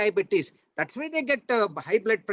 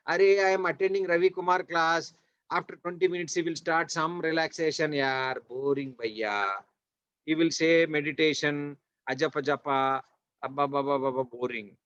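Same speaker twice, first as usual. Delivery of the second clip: low, droning mock complaint; imitating a bored pupil, sinking lower and trailing away; bored student; 8 semitones lower than usual. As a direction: grumble like a student bored by meditation class. Voices bored student mocking the class.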